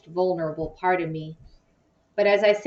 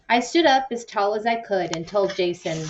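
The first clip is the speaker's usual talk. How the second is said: usual speaking voice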